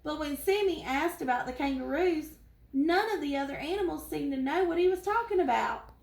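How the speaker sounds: ordinary delivery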